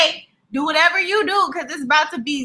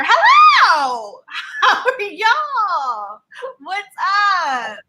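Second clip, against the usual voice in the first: excited shout; 5 semitones higher, much slower than usual. Real, not acted. Restarting the live greeting.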